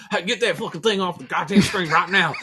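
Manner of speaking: loud, high Southern boss giving orders; Southern accent. Retold or imitated. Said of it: "the most Southern guy you ever met".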